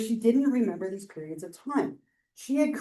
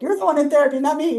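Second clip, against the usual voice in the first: loud, higher retort; an exasperated therapist snapping at her client; snapping back; 6 semitones higher, 8 dB louder, faster than usual. Retold or imitated. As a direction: fire it back at the client, indignant. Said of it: "not be like".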